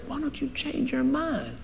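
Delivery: very soft, slow hiss of a tempter; whispering demon. Retold or imitated. Demon taunting the preacher to doubt, soft slow voice. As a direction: tempt him quietly and slowly.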